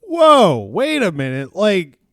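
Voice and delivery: high, loud whoa of surprise; loud surprised whoa, wait a minute; mock surprise. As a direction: do a stunned double take. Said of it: "You're like".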